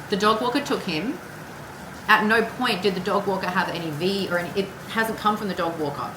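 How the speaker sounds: ordinary talk, nothing marked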